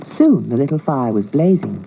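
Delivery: ordinary delivery, nothing marked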